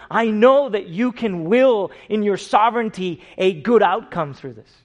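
loud, high, driving sermon voice; full-force preaching. Acted. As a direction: press the point home loudly.

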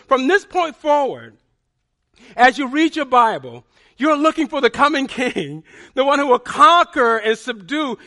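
loud, high, driving sermon voice, building conviction; fervent preaching. Acted. Pastor pressing the congregation, loud fervent preaching. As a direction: preach it out full force to the church. Preacher urges seeking the coming king.

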